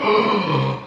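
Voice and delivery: sharp shocked gasp, imitated. Friends in a nightclub crowd gasping at the catch, mock gasp. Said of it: "everyone just went".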